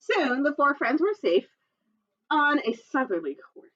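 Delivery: ordinary delivery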